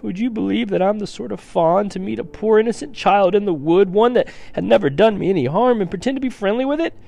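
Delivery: high, wailing outburst of guilt; anguished confession. Acted. The fawn confesses kidnapping to Lucy, wailing. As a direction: pour it out in theatrical anguish.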